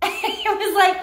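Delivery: ordinary delivery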